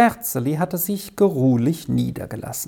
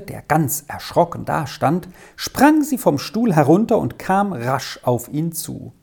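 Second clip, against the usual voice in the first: usual speaking voice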